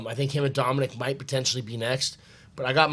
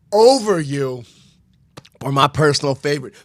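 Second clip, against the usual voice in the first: trash talk callout; 3 semitones higher, 9 dB louder than usual. Real, not acted.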